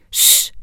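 sharp hissing "shh"; short hiss for silence; hissed shush. A narrator's reading. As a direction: hush someone with a hiss. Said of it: "hvæsede Oscar".

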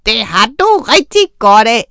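loud, gloating troll shout; angry shout. A narrator's reading. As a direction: yell it out with relish. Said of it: "råbte Kong Trolde".

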